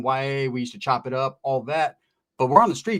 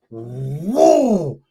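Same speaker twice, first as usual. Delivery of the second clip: retold whoa; 5 dB louder than usual. Reacting to the slap.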